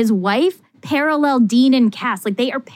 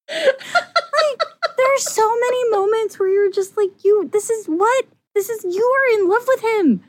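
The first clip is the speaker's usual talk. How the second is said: exasperated disbelief; 10 semitones higher than usual. Real, not acted. Exasperated fan yelling at the show.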